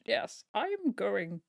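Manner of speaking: mock-posh British man introducing himself; male posh voice. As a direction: play a pompous upper-class Englishman, drawn out. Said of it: "like, a male posh".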